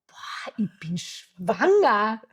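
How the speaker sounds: slow, stunned, drawn out; stunned realization. Retold or imitated. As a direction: let it sink in word by word. Realisiert allein die Schwangerschaft. (langsam und fassungslos, für sich allein)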